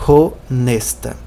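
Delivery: honesta slow, with the H sounded; honesta, breathe out a clear H; pronounced H. Shown as a demonstration. Teacher shows the spoken H in honesta. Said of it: "Então o H é pronunciado".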